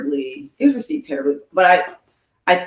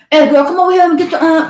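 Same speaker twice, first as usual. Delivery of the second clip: fast, higher, louder mock old neighbor calling kids over; neighbor with snuff; 10 semitones higher, 6 dB louder, faster than usual. Recalling Miss Jean calling the kids, a hammed-up snuff-mouthed call. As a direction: imitate an old lady talking round a mouthful of snuff. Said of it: "that's how she talked, because she always had snuff in her mouth".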